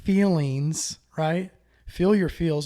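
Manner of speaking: loud and emphatic. Real, not acted. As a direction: push it out loud, driving the point. Emphatic advice, much louder.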